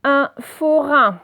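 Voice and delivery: loud, slow dictation of each syllable; deliberate classroom word reading. Shown as a demonstration. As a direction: sound the word out slowly for pupils. Teacher sounds out exercise word.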